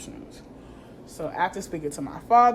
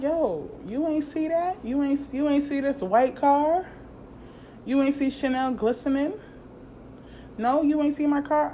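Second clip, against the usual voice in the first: mock outraged confrontation; 9 semitones higher than usual. Mocking the neighbour who hit her car.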